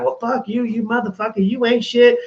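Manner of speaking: mock indignation, pitched high; imitated angry outburst. Imitating someone refused a favor, mock angry. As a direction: play the favor-seeker suddenly turning on you. Imitates ungrateful people turning on him.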